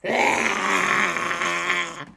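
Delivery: long drawn-out spooky ghost cry; ghostly wail. Acted. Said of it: "they were all going".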